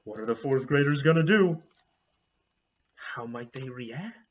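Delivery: usual speaking voice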